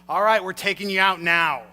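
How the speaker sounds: loud, menacing mob enforcer send-off; Godfather mobster. Retold or imitated. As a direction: escort him out like a mafia heavy. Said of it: "Godfather just comes, like, to mind".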